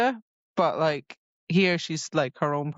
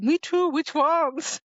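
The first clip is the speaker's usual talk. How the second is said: high, slow gushing Me too; eager delight; 11 semitones higher, slower than usual. Retold or imitated. Nesta finding a fellow romance reader, gushing. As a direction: squeal it like a thrilled reader. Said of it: "Nesta's like".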